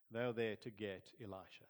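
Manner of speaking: ordinary talk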